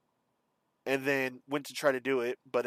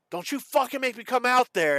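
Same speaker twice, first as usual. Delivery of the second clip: high, loud imitated threat; acted-out angry shout from another room; 9 semitones higher, 5 dB louder than usual. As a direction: yell a warning like a fed-up stepfather.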